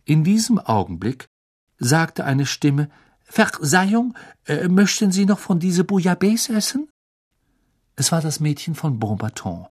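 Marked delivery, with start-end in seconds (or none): 3.2-6.9 a polite French visitor, voiced higher; French girl's voice. A narrator's reading. Beauxbatons girl asks Ron for the soup, higher.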